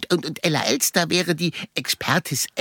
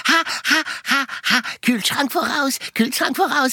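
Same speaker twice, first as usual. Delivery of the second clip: dancing bee; 8 semitones higher than usual. Retold or imitated. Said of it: "Bienen tanzen".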